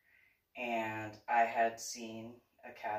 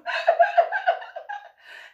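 high loud laugh; real laughter; 13 dB louder than usual. Real, not acted. Laughing at the memory.

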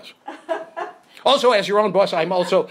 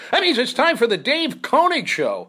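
show host intro; 5 semitones higher, 3 dB louder than usual. Acted.

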